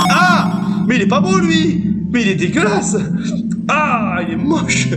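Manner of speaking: cri aigu de dégoût; s'écrie, horrifié par le monstre; dégoût surpris. Real, not acted. Découvre un monstre hideux. (surpris et dégoûté)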